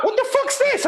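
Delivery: high, squeaky mock outrage; baffled outrage. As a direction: squeal it in disbelief. Retold outrage at truck stop.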